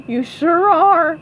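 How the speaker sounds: high, loud amused remark; amused Mister Krabs. A narrator's reading. Mister Krabs teases at the party, amused and loud. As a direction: say it just after a chuckle. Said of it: "Mister Krabs laughed".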